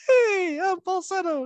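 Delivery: very high, loud falsetto hey; demoing the character's falsetto reading. Shown as a demonstration. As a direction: pop up into a silly falsetto. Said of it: "sometimes I read it as, like".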